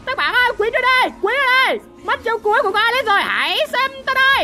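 high-pitched hyped gamer callouts; rally the team as the fight kicks off; excited battle call. Real, not acted. Spots enemies, hypes up the fight.

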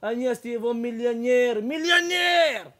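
mock wife's shout, high and loud, last word stretched slow; hammed-up Russian wife crowing; retold shouting wife. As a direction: yell it across the square like a proud wife. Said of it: "צעקה ברוסית".

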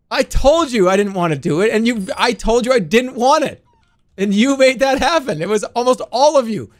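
high, loud complaining at chat; exasperated rant. Real, not acted. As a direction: scold the chat for making you do it. Streamer scolding chat for vote.

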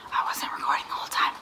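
hushed late-night whisper. Real, not acted. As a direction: whisper so nobody wakes up. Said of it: "we have to be quiet".

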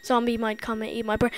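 ordinary delivery

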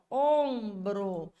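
OMBRO drawn out, first O long and nasal; nasal vowel. Shown as a demonstration. Teacher shows the vocalic digraph, slow nasal vowel. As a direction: stretch "ombro" as õm-bro, slowly. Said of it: "o O com o tiozinho".

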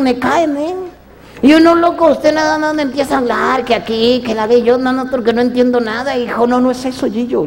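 put-on a streetwise young man brushing it off.

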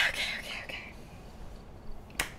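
nervous whisper. Real, not acted. Nervous before starting.

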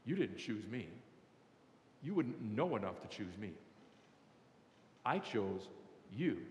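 low, soft, with weighty pauses; quiet, solemn divine voice. Acted. God telling the listener who chose whom, quiet solemnity.

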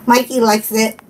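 normal talking voice

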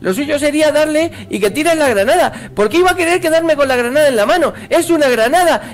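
exasperated rant. Real, not acted. Player raging at the grenade controls, exasperated rant. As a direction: rant at the game without letting up.

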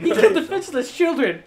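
high, loud outraged protest. Real, not acted. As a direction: protest in disbelief. Outraged retort.

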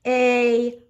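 ordinary delivery

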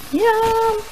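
high delighted squeal; excited yum. Real, not acted. Cooking show, food looks tasty.

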